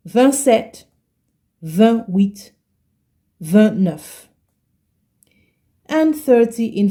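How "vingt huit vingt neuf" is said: ordinary delivery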